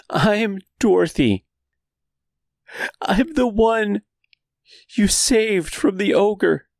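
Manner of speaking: high, slow desperate plea; pleading. Acted. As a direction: beg to be believed. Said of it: "the same pleading voice".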